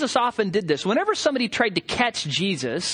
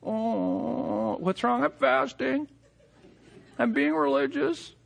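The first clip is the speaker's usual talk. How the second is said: softer, hammed-up self-pity; show-off faster fishing for sympathy; mock moaning faster; 5 semitones higher, 4 dB quieter, slower than usual. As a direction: sigh it out to get noticed. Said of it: "long face and moaning".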